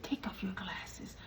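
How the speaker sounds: soft whispered aside; hushed request. Retold or imitated. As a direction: lean in and whisper it. Lady quietly approaching in line.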